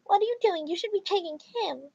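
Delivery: high-pitched put-on indignant mom; entitled mom. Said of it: "Titled Mom".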